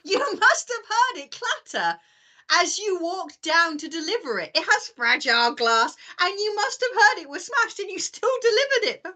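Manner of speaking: high, slow, drawn-out mock reproach; indignant scolding. Customer scolds the courier over smashed glass, in high indignant disbelief. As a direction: take the courier to task, stretching the words. Acting out scolding a delivery man.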